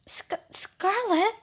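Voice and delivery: high, slow stammered Scarlet; flustered stammer. Acted. Embarrassed Toya stammering at Scarlet's teasing, flustered and high.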